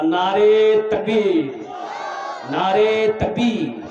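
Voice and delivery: slow, long drawn-out rallying shout; slogan shout. Real, not acted. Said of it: "अरे नारा लगाओ भाई". (ज़ोरदार नारा)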